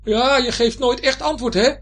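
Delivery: high, loud taunting shout; blustering high-pitched anger; angry bluster. A narrator's reading. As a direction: act tough and yell it crossly. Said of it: "al doet hij nu stoer van boosheid".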